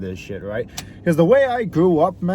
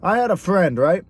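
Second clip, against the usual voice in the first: usual speaking voice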